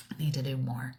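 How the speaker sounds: soft whisper to herself; whispered self-talk. Retold or imitated. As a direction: whisper a private admission. Retold private resolve, whispered.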